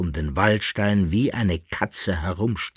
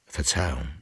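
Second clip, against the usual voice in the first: softer, lower murmured apology; dismayed murmur; 5 semitones lower, 5 dB quieter than usual. A narrator's reading. Young Waldstein apologises to the lady, dismayed murmur. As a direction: mumble it, thoroughly dismayed. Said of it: "murmelte der junge Waldstein ganz bestürzt".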